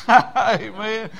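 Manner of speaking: amen said through a real laugh; laughing agreement. Real, not acted. Preacher amused at being called a hypocrite, laughing agreement. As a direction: chuckle out the word.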